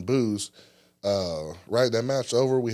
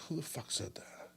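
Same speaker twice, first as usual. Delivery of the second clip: muttered under breath; 6 semitones higher, 16 dB quieter than usual. Retold or imitated. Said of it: "I was like".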